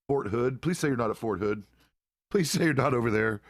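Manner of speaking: real worried begging; worried pleading. Real, not acted. Dreading the listener's army posting.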